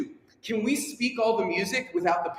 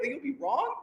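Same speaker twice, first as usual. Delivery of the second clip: normal talking voice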